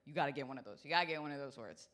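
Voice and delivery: ordinary delivery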